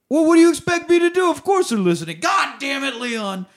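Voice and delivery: high, loud hammed-up shout; a squealing, exasperated mock outburst; mock yell. Sonny yelling at Leon.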